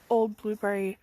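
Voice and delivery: ordinary talk, nothing marked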